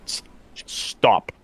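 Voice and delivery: whispered, growling stop; growled creature voice. Acted. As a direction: rasp it like a wolf. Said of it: "and goes".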